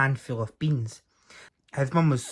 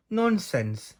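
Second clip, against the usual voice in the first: dismissive wife; 7 semitones higher than usual. Acted. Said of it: "said his wife".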